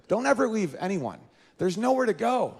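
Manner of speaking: normal talking voice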